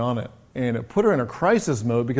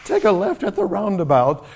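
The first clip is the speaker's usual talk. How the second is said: much higher, mock helpful old lady giving directions; chirpy old English lady; 9 semitones higher than usual. Imagined old lady cheerfully sends the lost boy down the road. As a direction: play her as brightly unhelpful, giving road directions to a child. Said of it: "What if she'd have said".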